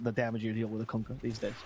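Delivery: ordinary talk, nothing marked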